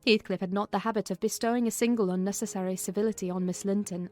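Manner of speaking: ordinary talk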